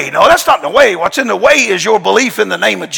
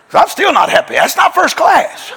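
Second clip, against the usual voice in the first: high, laughing bratty whine; hammed-up spoiled gripe; mock complaint; 10 semitones higher than usual. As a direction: whine about first class with a laugh. Complaining jokingly about his seat.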